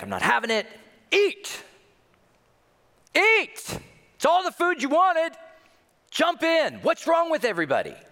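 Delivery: high, loud outburst of an angry father; exasperated yelling. Retold or imitated. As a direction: act a dad losing it at the dinner table. Retold father snapping at crying family.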